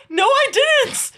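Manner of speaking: high, loud, drawn-out protest; panicked denial. Real, not acted. Streamer denying to Sal that she asked to join, panicked and loud. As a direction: cry it out in alarmed denial. Flustered denial, said over louder.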